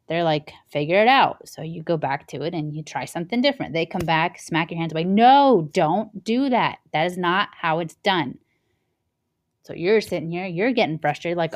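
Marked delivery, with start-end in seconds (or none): 0.7-1.5 louder, curt brush-off; dismissive boss. Retold or imitated. Boss brushing off the new worker, curt and louder. 5.0-8.4 loud sharp no, then slow firm don't do that; scolding boss. Retold or imitated. Boss smacking the worker's hands away, loud scolding.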